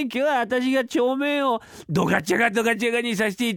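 ドガチャガドガチャガ rattled off high and merry by the clerk; giddy boast. Acted. Clerk brags of fudging the books, high and merry.

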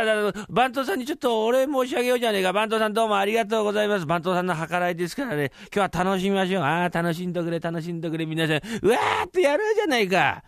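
high-pitched, merry servants toasting the head clerk; rally everyone to party, pitched high; servant revelers in high spirits. Acted.

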